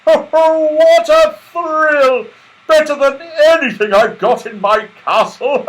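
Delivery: high, loud, very slow thrilled laughing; jolly Santa laugh. A narrator's reading. Santa after sliding down, laughing with delight. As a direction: roar with festive glee.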